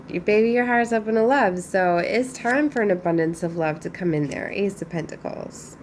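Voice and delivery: ordinary talk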